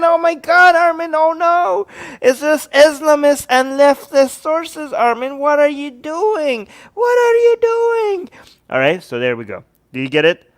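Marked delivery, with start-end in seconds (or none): 0.0-8.3 very high, drawn-out mock lament; mock horrified wailing. Host plays viewers scolding him over sources, mock horror. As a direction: wail like a scandalized critic, hammed-up.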